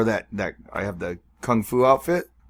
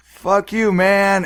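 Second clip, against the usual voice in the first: higher, slightly strained mock defiance; imitated voice crack; 7 semitones higher, much slower than usual. Imitating a guy backing down, voice cracking. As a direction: lightly mimic a cracking voice. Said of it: "the guy's voice cracked when he said it".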